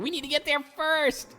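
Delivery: high, urgent mock cry; frantic archaeologists. Voicing his archaeologists racing to dig sites, high frantic cry. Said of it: "all my archaeologists are like".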